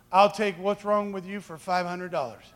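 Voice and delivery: usual speaking voice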